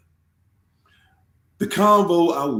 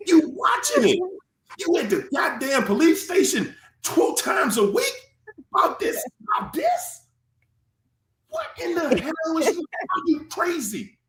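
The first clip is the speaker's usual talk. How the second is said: higher, shouting scold in bursts; imagined scolding; 7 semitones higher than usual. Retold or imitated. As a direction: yell at him like a fed-up friend. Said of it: "like, Get your ass over here".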